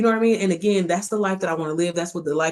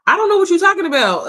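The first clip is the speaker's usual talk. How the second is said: baffled exclamation; 11 semitones higher, 7 dB louder than usual. Real, not acted. Reacting to a viewer's comment.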